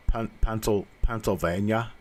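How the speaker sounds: mild Pennsylvania accent. Shown as a demonstration. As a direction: lightly say it like the hill folk. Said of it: "Pennsylvania accent".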